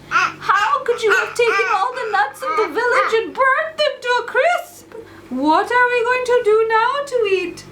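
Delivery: a hint of a distressed mother; upset mom. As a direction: play the shocked mom scolding her boy. Mom scolding her son.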